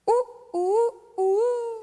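high-pitched crowing rooster imitation; loud falsetto cock-a-doodle call. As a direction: crow like a rooster, last note held. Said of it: "That's a rooster".

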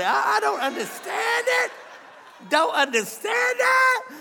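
husband laughing about his wife's slow minute rice; laughing disbelief. Real, not acted. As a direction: laugh it out in mock bafflement.

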